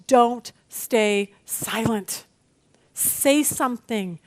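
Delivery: very slow, word by word; deliberate, measured charge with space between words; emphatic command. Retold or imitated. Quoting Jesus urging Paul to speak up, slow emphatic command.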